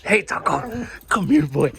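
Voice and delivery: ordinary talk, nothing marked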